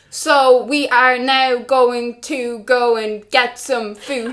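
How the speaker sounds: stiff, slow robotic monotone. Retold or imitated. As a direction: speak slowly and stiffly, like a shy robot. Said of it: "talking like a robot".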